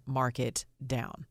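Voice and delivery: usual speaking voice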